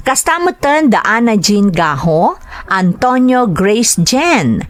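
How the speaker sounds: ordinary talk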